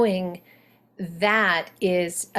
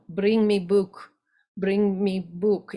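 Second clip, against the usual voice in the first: slow, lightly voiced Russian accent; slower than usual. Shown as a demonstration. Coach shows Russian dropped articles, Russian accent. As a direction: demand the book with a Russian accent. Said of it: "And then Russian".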